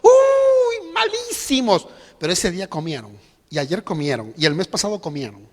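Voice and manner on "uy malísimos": high, whiny groan of a complainer; mock complaining reply. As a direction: whine it like someone who always complains. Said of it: "Yo siempre pregunto". (quejido exagerado y agudo de quien siempre se queja)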